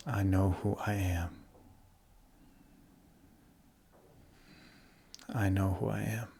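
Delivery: calm, softly settling meditation voice with long pauses; guided meditation. Real, not acted. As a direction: guide them gently into the body, leaving space to breathe.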